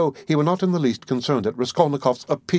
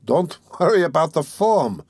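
higher, sly smiling interruption; sly gloating; 7 semitones higher, 3 dB louder than usual. A narrator's reading. Examining lawyer gloating over the suspect, slyly smiling. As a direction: cut in smoothly, enjoying his discomfort. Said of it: "with the same sly smile".

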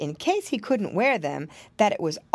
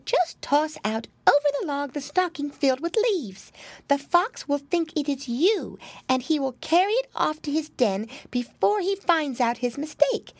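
high-pitched storybook mouse; small, high creature voice; little mouse; 9 semitones higher than usual. A narrator's reading. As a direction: advise as a clever tiny mouse. Mouse explains the plan.

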